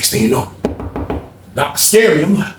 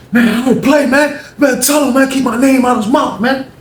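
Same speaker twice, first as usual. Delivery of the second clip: higher, heated warning voice; angry warning; 10 semitones higher than usual. Retold or imitated. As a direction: warn him off hotly. Ronald warns someone off.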